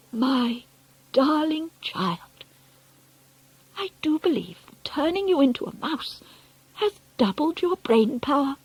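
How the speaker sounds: high, hushed, amazed grandmother; tender wonder. A narrator's reading. Grandmother marvels at her darling child, tender awe.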